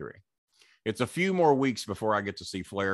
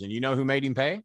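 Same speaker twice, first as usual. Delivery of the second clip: ordinary talk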